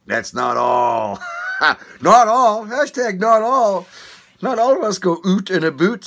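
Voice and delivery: high, loud insistence on not all; laughing, shouting protest. Real, not acted. Protesting the oot stereotype.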